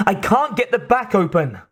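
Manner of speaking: high-pitched strained cry; scream. A narrator's reading. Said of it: "she screamed at Connor".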